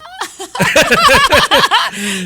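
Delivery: big high laugh, cracking up; delighted shriek of laughter; loud burst of laughter. Real, not acted. Laughing at the punchline.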